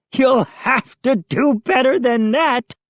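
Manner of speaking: high mocking gnome. A narrator's reading. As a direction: taunt like a cheeky gnome. Gnome mocks the children.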